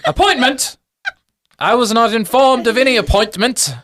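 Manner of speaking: put-on high, affronted old academic squawk; fussy, high-pitched outrage; indignant gnome professor. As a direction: protest an unexpected visit in a high voice. Gnome professor indignant at surprise visitors.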